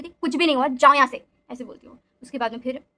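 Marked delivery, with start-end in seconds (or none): 0.2-1.2 loud, snapping at them to get out; sudden harsh tone. Retold or imitated. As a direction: order them out of the room, loud and sharp. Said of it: "अचानक से उसकी tone बहुत बदल जाती है".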